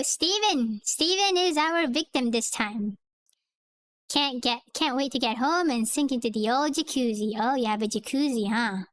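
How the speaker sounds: ordinary talk, nothing marked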